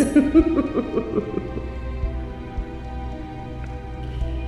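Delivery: high, soft villainous laugh; mocking giggle. Queen ridiculing the rebel's garb, amused mocking giggle. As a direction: titter lightly at your captive.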